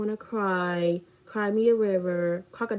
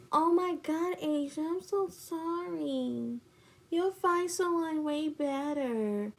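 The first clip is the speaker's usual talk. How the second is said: fake comforting; 7 semitones higher, 4 dB quieter than usual. Friend consoles her after the breakup, fake comforting. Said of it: "fake comforting me".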